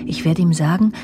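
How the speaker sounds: normal talking voice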